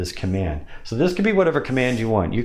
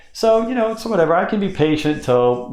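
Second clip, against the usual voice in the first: much higher and louder; high pitch; 10 semitones higher, 3 dB louder than usual. Shown as a demonstration. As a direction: start it up high and easy. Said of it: "let me do that again and show you".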